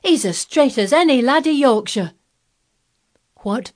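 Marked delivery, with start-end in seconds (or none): 0.0-2.1 high, loud Yorkshire lad; exultant country boy, voice raised; cried out. A narrator's reading. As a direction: cry it out proudly, voice rising. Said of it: "cried Dickon".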